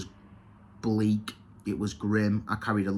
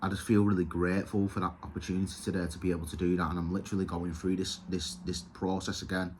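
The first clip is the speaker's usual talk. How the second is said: fighting back tears while grateful; choked up; 5 semitones lower, 7 dB quieter, faster than usual. Real, not acted. Recovering man shares his step work, getting emotional and quiet. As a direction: voice dropping low and quiet with real emotion, words rushing out. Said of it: "And I'm getting emotional with that stuff".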